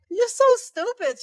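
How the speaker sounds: high, loud mock scolding; imitated friend's scolding. Friend scolding her, retold.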